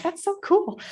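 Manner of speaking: high-pitched retold amazement; amazed. Quotes own amazed reaction.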